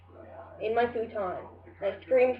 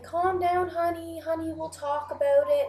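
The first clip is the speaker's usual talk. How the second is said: higher, lightly sing-song soothing mom voice; 7 semitones higher than usual. Retold or imitated. Mom calming the upset dreamer, higher and coaxing. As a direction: mimic a mother calming her child, pitched up.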